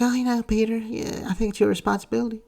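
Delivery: high-pitched mocking imitation of an aunt; mock do-gooder falsetto; Aunt May. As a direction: put on a high, pleading old-aunt voice. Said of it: "And Aunt May's like".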